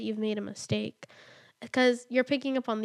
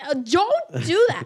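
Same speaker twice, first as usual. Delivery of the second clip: higher, louder, slow drawn-out don't do that; exasperated louder plea; 6 semitones higher, 10 dB louder, slower than usual. Retold or imitated. Mother quoting her rising frustration, louder and drawn out. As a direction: plead with yourself, losing patience. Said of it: "so many instances where I'm like".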